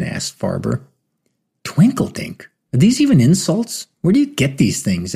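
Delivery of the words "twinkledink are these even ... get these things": incredulous, looking down on him; condescending. A narrator's reading. Teebs scoffing at Farber's insult, condescending. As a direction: sneer at the silly insult. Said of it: "with enough condescension".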